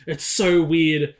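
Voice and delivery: ordinary talk, nothing marked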